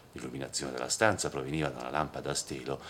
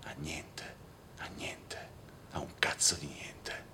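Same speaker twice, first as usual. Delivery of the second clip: soft, bitter whisper to himself; whispered, bitter self-answer; 5 dB quieter, slower than usual. Acted. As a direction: hiss it under your breath, disgusted.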